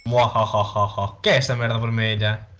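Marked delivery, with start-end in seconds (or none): 0.0-1.2 slow, low villain laugh; evil cackle. Acted. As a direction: cackle like a mustache twirling villain.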